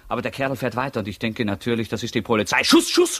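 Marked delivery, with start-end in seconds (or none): 2.6-3.2 sudden high burst of a shout; shouted gunshot. Retold or imitated. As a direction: bark it out like a gunshot. Gunfight in the retold adventure. (laut herausgeschleuderter Schuss)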